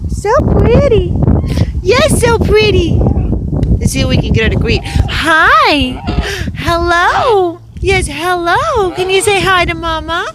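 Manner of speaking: high, bright sing-song voice for the bird; pet talk. Acted. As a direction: coo at the bird like a baby. Talking to her pet bird.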